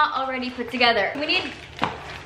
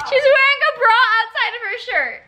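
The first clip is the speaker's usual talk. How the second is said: much higher, louder, cracking with laughter; laughing shout; 10 semitones higher, 3 dB louder than usual. Real, not acted. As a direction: shout it in disbelief, half crying with laughter. Shouting at grandma's outfit.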